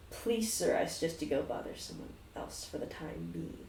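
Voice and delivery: whispered polite warning; hushed bartender. Acted. Bartender quietly shooing a customer, unvoiced whisper. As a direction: lean in and hiss it under breath. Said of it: "says again".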